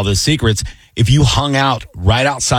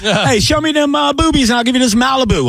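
much higher, cartoonish come-on; sleazy guy voice; 13 semitones higher than usual. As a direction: ham up a leering party guy. Acted sleazy offer to girls.

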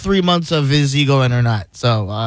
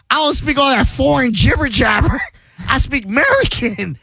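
higher mock shout at the orderlies; 9 semitones higher than usual. Retelling hospital patient yelling, mock bigot shout. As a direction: yell it like an ignorant old bigot. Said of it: "He goes".

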